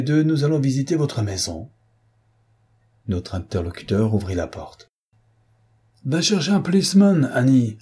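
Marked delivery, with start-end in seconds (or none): 6.0-7.8 appel nettement plus aigu, un peu plus fort; crié. Acted. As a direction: lancer l'ordre vers la domestique. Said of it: "cria-t-il". (crié vers Annie, voix montée)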